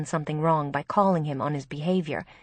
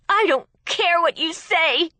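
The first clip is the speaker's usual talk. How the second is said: loud, high-pitched spiteful retort; bitter, defiant outburst; venomous; 11 semitones higher, 6 dB louder than usual. A narrator's reading. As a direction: lash out at your sister with venom. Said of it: "Gracie said venomously".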